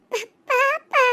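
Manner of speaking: small tearful plea; about to cry. Acted. Child begging to hold the baby, on the verge of tears. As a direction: plead as if tears are starting. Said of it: "டைமே அழுக ஆரம்பிச்சிருவான் போல".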